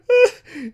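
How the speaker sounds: shrieking laughter; high laugh. Real, not acted. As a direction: burst out laughing. Laughing hard.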